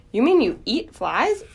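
high, appalled question; shocked gasp. Acted. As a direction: ask in horrified disbelief. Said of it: "gasped Wilbur".

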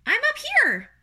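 very high little spider voice; tiny creature calling from above; small voice. A narrator's reading. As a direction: pipe up in a tiny squeak. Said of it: "he heard a small voice".

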